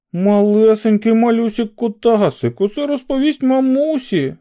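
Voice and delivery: put-on high, loud teasing baby-talk; mock him like a schoolyard bully; mocking singsong taunt. A narrator's reading. Kins jeers at Barkovich in high mocking singsong. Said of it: "гукнув Кінс".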